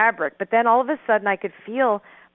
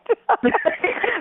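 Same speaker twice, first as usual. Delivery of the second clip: high laugh; 12 semitones higher than usual. Real, not acted. Laughing at her own terror.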